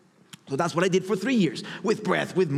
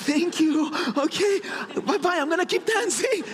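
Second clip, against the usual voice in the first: much higher, a laughing, breezy woman's voice; laughing brush-off; 13 semitones higher than usual. Retold or imitated. The woman at the party brushing him off, high and laughing. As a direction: wave him off politely.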